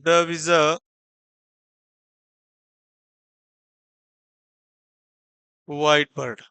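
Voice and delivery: slow word by word with long pauses; slow dictation. Shown as a demonstration. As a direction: read it out slowly for writing. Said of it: "तो इसको क्या पढ़ा गया है".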